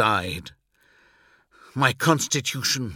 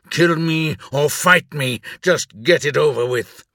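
loud, angry challenge; growled demand; 4 semitones higher, 6 dB louder than usual. A narrator's reading. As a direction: demand a fight, forcefully. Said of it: "I growled".

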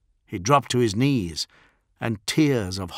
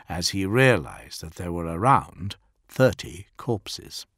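usual speaking voice

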